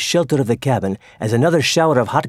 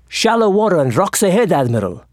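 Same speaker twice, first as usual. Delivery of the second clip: lookout's cry; 6 semitones higher than usual. A narrator's reading. Lookout warning the admiral of rocks, urgent cry. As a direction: call the danger out across the deck. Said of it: "Suddenly the lookout cried".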